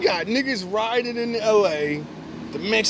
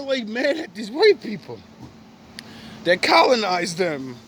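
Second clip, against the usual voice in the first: usual speaking voice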